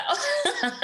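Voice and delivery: bright burst of laughter up high. Real, not acted. A guest laughs at being called a Texas girl. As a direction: laugh it off, amused at herself.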